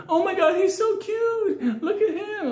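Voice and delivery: very high, drawn-out mock girl squeal; cooing girl. Man imitating a girl cooing at a baboon, falsetto gushing. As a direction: coo it slowly in falsetto. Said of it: "the girl's like".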